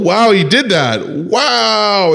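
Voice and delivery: high, overdone fake awe; hammed-up wowed reaction; mock amazement. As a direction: gush sarcastically like an easily impressed fan. Mocking easily impressed fans.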